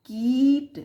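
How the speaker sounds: कीट with a loud, held ee; stretched long vowel. Shown as a demonstration. Teacher repeats the long i example, stretched vowel. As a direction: draw out the ee in कीट. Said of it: "थोड़ा बढ़ा करके बोलेंगे".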